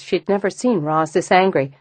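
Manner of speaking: usual speaking voice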